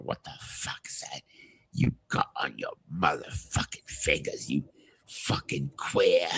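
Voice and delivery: slow, whispered British pub threat; British accent. Retold or imitated. Retelling the documentary's pub thug, whispered British accent. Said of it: "the way he said in the documentary was like".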